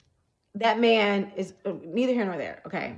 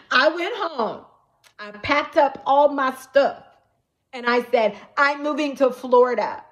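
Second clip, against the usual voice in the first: higher, louder, slow laughing declaration; excited; 6 semitones higher, 6 dB louder than usual. Real, not acted. Telling viewers she moved away, higher and louder. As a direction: announce it with giddy resolve. Laughing-crying about moving.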